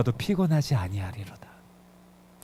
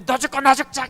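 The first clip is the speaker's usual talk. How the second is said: raised, higher desperate shout; desperate outcry; 7 semitones higher, 5 dB louder than usual. Retold or imitated. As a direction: cry it out like a mother at wits' end. Mother's despair before faith, quoted. (절망에 찬 높은 외침)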